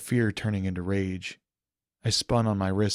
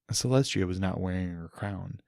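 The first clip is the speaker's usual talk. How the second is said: usual speaking voice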